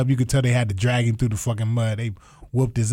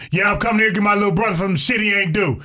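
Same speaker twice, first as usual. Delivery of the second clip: mild mock yell; 6 semitones higher than usual. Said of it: "loud as shit".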